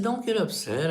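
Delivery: high-pitched retold reassurance; placating dad. Dad to his tearful daughter, high-pitched placating. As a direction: lift the pitch to placate her.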